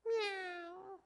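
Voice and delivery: very high, squeaky mock meow; a tiny silly cat sound from a big dog. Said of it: "comes up and goes".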